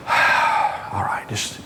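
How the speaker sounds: heavy exhale into a whisper; whispered, psyching yourself up; bracing sigh. Retold or imitated. Inmate steels himself for the needle, whispering.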